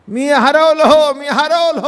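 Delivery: high, loud, drawn-out call; crying out. Acted. Lost man shouting to the whole market, crying out. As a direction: holler it like a man lost in a crowd. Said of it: "ओरडू लागला".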